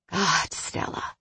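soft, slow rasp against her lips. A narrator's reading. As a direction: rasp it quietly, overcome by the kiss. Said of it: "he rasped against her lips".

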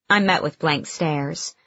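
ordinary delivery, nothing marked

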